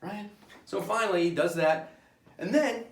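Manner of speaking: ordinary delivery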